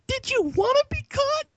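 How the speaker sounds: very high mocking question; squeaky voice. Mock judge question.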